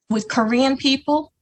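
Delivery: ordinary talk, nothing marked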